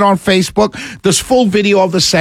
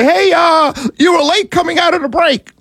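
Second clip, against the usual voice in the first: mock boss shout; 10 semitones higher than usual. Management scolding host for a late return, mock shout. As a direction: yell it like the boss scolding him. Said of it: "and they'll say".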